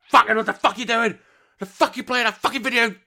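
high, loud mock rage at a video game; gamer swearing outburst. Gamer cursing at the screen within earshot of the missus, mock furious. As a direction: rant like a player losing it. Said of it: "all she hears is".

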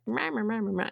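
a lightly voiced, droning rah rah; mocking Doctor Phil's drone. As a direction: mimic a preachy TV host droning on. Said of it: "Doctor Phil's voice is just".

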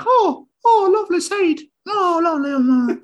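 tiny bird delighted by seed; a squeaky sparrow cooing over food; little sparrow. Pretend. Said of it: "some little sparrow's like".

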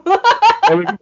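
loud, high burst of laughter; big cackling laugh of delight. Real, not acted. Laughs after declaring love for him.

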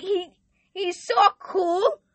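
normal talking voice

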